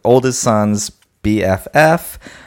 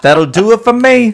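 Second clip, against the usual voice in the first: much higher, louder mock take; quoting the line; 10 semitones higher, 5 dB louder than usual. Host trying the line again, high loud mock. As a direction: land the line with a flourish. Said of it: "That's the line".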